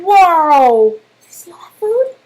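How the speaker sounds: loud astonished gasp then high question; wide-eyed wonder at the feast; amazed. Acted. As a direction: marvel at the food for the children. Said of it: "narrator amazed at the food".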